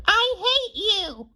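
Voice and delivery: slow, very high mock shriek; imitated scream. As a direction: mimic a drawn-out tantrum scream, pitched sky-high. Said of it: "scream as loud as she can".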